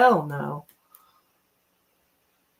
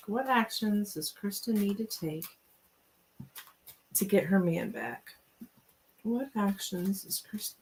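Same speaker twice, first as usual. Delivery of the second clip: quiet rephrasing; 7 dB quieter than usual. Shown as a demonstration.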